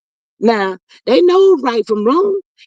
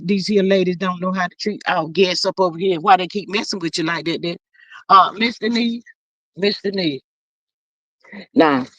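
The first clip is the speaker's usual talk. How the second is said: normal talking voice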